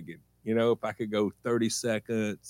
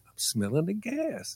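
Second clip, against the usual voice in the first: put-on higher, softer little-kid reply; child's innocent answer; 10 semitones higher, 5 dB quieter than usual. Kid caught at the gas pump answers mom, sheepish. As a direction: answer Mom meekly, pitched up.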